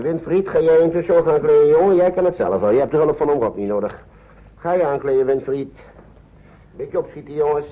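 a cheerful, firm summons to hurry; calling out. Acted. Oom Rob calls to Winfried to get dressed, cheerful call.

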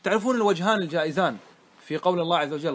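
ordinary talk, nothing marked

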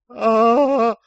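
loud, high, long scream; muffled scream. A narrator's reading. Captive's nose cut, loud high stifled scream. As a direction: scream out in pain under a hand. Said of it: "заорал Шустрый".

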